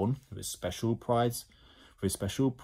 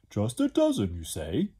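mischievous. Acted. Slime queries Ned's number, mischievous. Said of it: "asked Slime mischievously".

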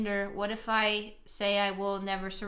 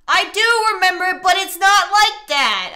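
loud, raised, insistent objection; exasperated protest; 6 semitones higher, 11 dB louder than usual. Real, not acted. Player correcting the game partner's memory of things, exasperated. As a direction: argue back loudly, voice pitched up. Arguing with the game's accusation.

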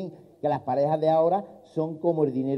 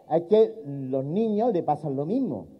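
usual speaking voice

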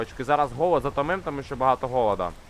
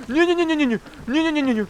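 high rapid panicked no-no-no; 12 semitones higher, faster than usual. Real, not acted. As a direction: blurt it out as you fall. Falling off the mushroom.

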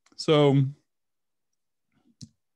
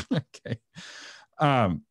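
usual speaking voice

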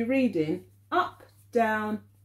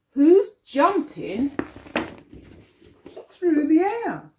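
lively read-aloud; 6 semitones higher, 5 dB louder than usual. Acted. Teacher reading to children, lively, higher and louder. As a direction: pump up the jump for the kids.

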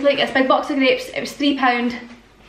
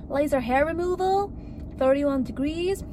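a failed stab at a Los Angeles drawl; mock LA accent; 3 semitones higher, 6 dB quieter than usual. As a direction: try a breezy LA accent and miss. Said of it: "That is not the accent".

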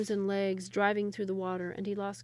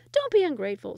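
higher, louder scolding villager; another villager; 6 semitones higher, 9 dB louder than usual. Acted. Second villager scolds the first, higher and louder. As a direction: scold him for grumbling. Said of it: "said another voice".

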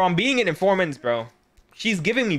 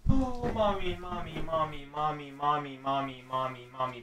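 helpless, hushed swooning; needy pleading; 8 dB quieter than usual. Pretend. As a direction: whimper it over and over. Pleading to the video's character.

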